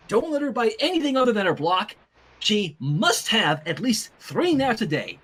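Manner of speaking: plain reading voice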